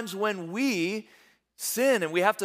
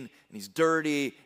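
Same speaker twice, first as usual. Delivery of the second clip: ordinary delivery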